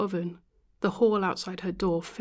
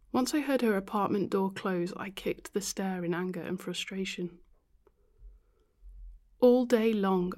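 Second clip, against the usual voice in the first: ordinary delivery, nothing marked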